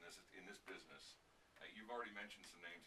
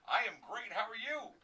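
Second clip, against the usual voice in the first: much higher and louder; excited on-air greeting; 11 semitones higher, 12 dB louder than usual. Real, not acted. Host and guest trade a loud, pumped-up hello. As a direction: greet them with big radio energy.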